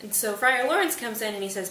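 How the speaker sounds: plain reading voice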